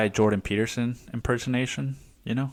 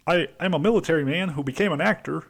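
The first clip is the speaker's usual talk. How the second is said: higher, fast mock Adam Driver voice; hammed-up Adam Driver, pushing ahead; mock tough-guy actor voice, rushed and high; Adam Driver impression; 6 semitones higher, faster than usual. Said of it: "it's so fucking fun to do Adam Driver impersonation".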